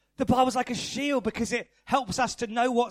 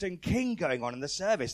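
ordinary talk

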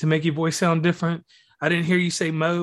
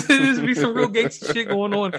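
higher, laughing through the words; 6 semitones higher than usual. Real, not acted. Host joking about podcast wars, laughing as he talks. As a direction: talk through a laugh, pitch up.

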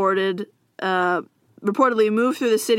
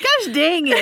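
grumpy bishop; 6 semitones higher, slower than usual. Pretend. Voicing angry bishop.

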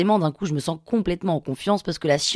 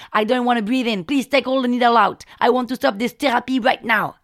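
high, raised, desperate demands; frantic insistence; 5 semitones higher, 3 dB louder than usual. Retold or imitated. Patient demands healer end therapy, high and frantic. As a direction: demand it stops now.

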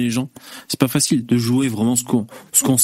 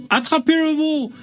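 calling out. Retold or imitated. Calling out at the branding.

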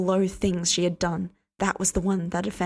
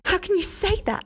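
much higher, repeat it rising to a shriek; shrieking outrage; 12 semitones higher than usual. Acted. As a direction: push the repeat higher in disbelief.

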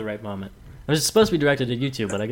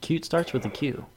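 ordinary talk

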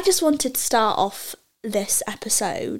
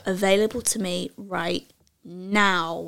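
slow affirmation; 3 semitones lower, 4 dB quieter, much slower than usual. Shown as a demonstration. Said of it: "in three words".

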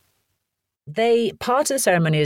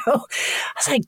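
ordinary delivery

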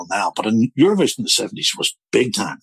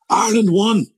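excited news; 12 semitones higher than usual. Retold or imitated. Neighbour at mass proudly announcing Ireland's win, excited. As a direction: announce it like a triumph. Churchgoers share the big news.

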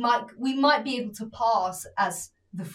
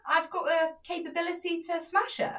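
high, bold pep talk to yourself; confident self-talk; 7 semitones higher than usual. Retold or imitated. Telling a friend to back herself: high, confident self-talk. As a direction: psych yourself up, chin up and high.

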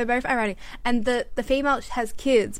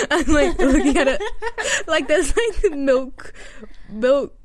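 louder, higher, laughing through words; 4 semitones higher, 7 dB louder, slower than usual. Real, not acted. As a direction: crack up while talking. Laughing while telling.

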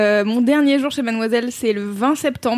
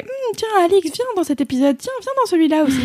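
higher, sneaky coaxing voice; sly little ferret; 7 semitones higher, faster than usual. Retold or imitated. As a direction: lure her in, slyly. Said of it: "ma petite fouine".

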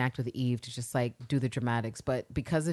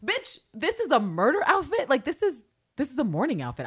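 higher-pitched mock indignation; lightly exasperated, pitched-up correction; Villanelle's indignant retort; 7 semitones higher than usual. Voicing the assassin correcting a remark on her outfit, indignant. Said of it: "She's like, Bitch".

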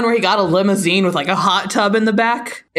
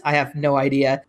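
low-pitched acted answer; deadpan reply; 6 semitones lower, 3 dB quieter than usual. Reader voicing the other friend in the exchange, low deadpan. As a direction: drop low for the second character.

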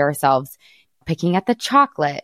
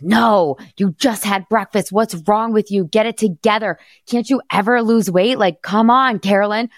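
harsh inner critic; 4 semitones higher than usual. Retold or imitated. Mother scolding herself over a snack, harsh and pushy. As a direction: berate yourself like a drill sergeant. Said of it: "this is how my brain used to sound".

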